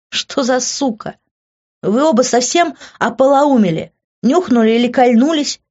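loud, high outraged cry; exclaimed. A narrator's reading. Heroine yelling at Grant and Rita, outraged. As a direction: shout it out in disbelief. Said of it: "воскликнула я".

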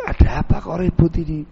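ordinary delivery

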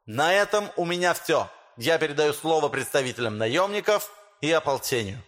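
high, lisping speech with sh for s. Acted. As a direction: lisp through a rousing public speech. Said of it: "По шепелявому голосу".

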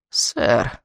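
put-on puffing, winded old lady; out of breath. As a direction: gasp it out breathlessly. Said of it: "пропыхтела она". (запыхавшись)

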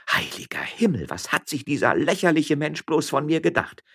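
high, incredulous accusations; indignant woman working it out aloud; scandalised miller's wife. Acted.

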